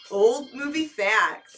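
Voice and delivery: loud, slow slogan; slow catchphrase delivery. Acted. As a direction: belt it out slowly. Segment catchphrase.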